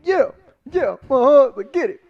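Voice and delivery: high, loud hype-man shouting. Pretend. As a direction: pump up the crowd, way up high. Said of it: "Hype 'em up".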